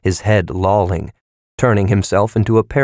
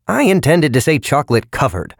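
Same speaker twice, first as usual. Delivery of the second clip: agitated salesman; 7 semitones higher than usual. A narrator's reading. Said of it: "droned on in agitation".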